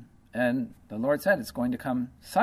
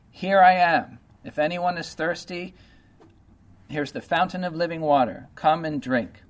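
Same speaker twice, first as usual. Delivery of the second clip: higher, ringing proclamation, loudest on the opening words; calling out; 4 semitones higher, 4 dB louder than usual. Retold or imitated. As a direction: call out an open invitation to the crowd. Preacher voices the Lord's invitation.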